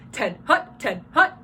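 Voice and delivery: loud, sharp, separated drill call; crisp command, no drawl. Shown as a demonstration. Coach shows the right call without accent, crisp command. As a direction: snap out TEN and HUT short and hard.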